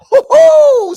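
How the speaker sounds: high, loud gleeful hoot; excited whoop. Real, not acted. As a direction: whoop it up with glee. Excited hype over the odds.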